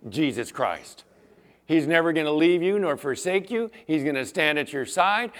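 ordinary talk

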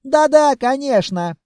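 high, loud, excited yes; eager agreement. A narrator's reading. Merchant agrees to talk with visitor, high excited eagerness. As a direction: jump in eagerly.

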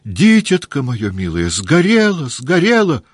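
high, loud tearful wail; sobbing. A narrator's reading. Mother mourns her burned child, loud wailing sobs. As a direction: wail the word сгорело twice in grief. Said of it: "опять она зарыдала".

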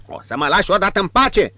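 high, loud, fast complaint; exasperated snap. Acted. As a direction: push him away impatiently. Innkeeper exasperated.

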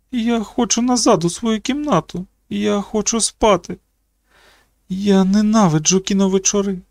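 put-on high, whiny voice of a miserable girl; plaintive, near tears. A narrator's reading. Girl begging to go back to her room, plaintive. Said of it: "Голос її звучав жалібно, от-от розплачеться".

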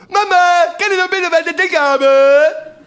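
put-on high, loud, whiny little kid; child voice. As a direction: beg like a small child. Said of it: "Und die Kinder reden auch schon so". (hohe, laute Kinderstimme)

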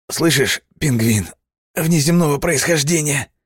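high strained teasing voice; high teasing through pain. Acted. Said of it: "сквозь боль улыбаюсь я".

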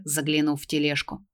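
ordinary delivery, nothing marked

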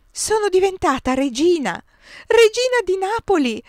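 ordinary delivery, nothing marked

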